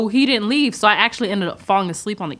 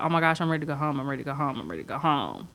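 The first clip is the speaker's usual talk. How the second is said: softer and lower each time, fading away; sinking deeper into boredom; fading inner moan; 6 semitones lower, 10 dB quieter, faster than usual. Retold or imitated. Bored on a bad date, moaning softly to herself.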